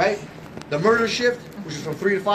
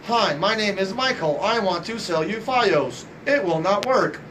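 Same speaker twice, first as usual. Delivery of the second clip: slow, evenly paced robot voice; slower than usual. Shown as a demonstration. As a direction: drone the pitch like a robot, word by word. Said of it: "you sound like a robot".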